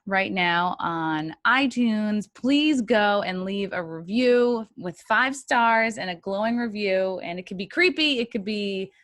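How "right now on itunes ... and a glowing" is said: louder, punchy show intro; podcast announcer. Acted. Host welcoming listeners and asking for reviews, announcer style. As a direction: announce the show and plug the platforms.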